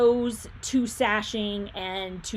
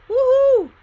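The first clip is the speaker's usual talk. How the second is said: cheering; 12 dB louder than usual. Real, not acted. Cheering a Bruins goal.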